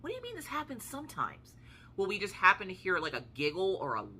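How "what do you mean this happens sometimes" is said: hushed, high-pitched disbelief; retold incredulity, pitched way up; incredulous reply. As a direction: squeak it softly in disbelief.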